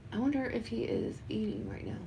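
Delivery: soft unvoiced whisper aside. Real, not acted. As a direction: whisper it to yourself.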